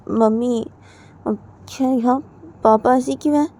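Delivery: put-on little girl asking mama; curious little girl. Daughter asking her mother, lightly childlike.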